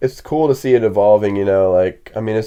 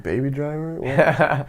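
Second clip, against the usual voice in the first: confused; 4 semitones higher, 5 dB quieter, slower than usual. Retold or imitated. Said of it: "I was like".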